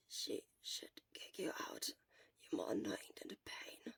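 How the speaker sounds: soft unvoiced whisper; weak whisper. Weak patient whispering at his chattering friend. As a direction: hush him with barely any voice.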